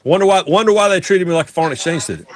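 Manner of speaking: normal talking voice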